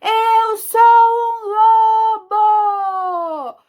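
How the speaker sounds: long, drawn-out loud howl of words; big howling wolf yell; wolf's shout. Acted. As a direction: bellow it high, stretching every word slowly. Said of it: "Eu vou gritar".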